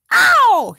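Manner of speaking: high, loud yelp of pain; shouted ow. Acted. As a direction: yelp after being smacked on the head. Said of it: "he shouted".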